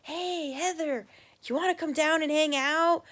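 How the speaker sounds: mild fake excitement. Kid planning a prank on the neighbor girl, mock excited. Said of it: "I'll be all excited and I'll say".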